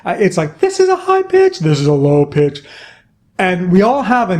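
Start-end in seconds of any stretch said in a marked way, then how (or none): 0.6-1.6 much higher squeaky demo voice; high pitch. Shown as a demonstration. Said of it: "pitch is how high or how low we speak". 1.6-2.6 low pitch. Shown as a demonstration. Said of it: "pitch is how high or how low we speak".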